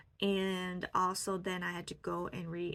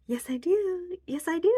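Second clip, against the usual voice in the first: high, louder, fond insistence; loving insistence; 10 semitones higher, 9 dB louder than usual. Retold or imitated. Telling her younger self she is loved, loving insistence. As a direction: insist warmly, like to a toddler. Insisting love to inner child.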